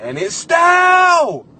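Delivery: slow yelled outrage; drawn-out shocked yell; shout. Retold or imitated. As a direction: bellow it out in mock horror. Wife finds gum, shouting.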